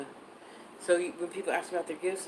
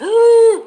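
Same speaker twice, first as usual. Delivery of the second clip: mock gasp; 20 dB louder than usual. Mock alarm inside reassurance.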